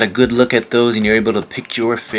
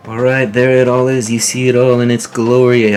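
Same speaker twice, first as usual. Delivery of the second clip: louder, higher showman's announce; excited reveal; 4 semitones higher, 5 dB louder than usual. Real, not acted. Unveiling his eBay haul to viewers, excited and louder. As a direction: show off the whole haul proudly.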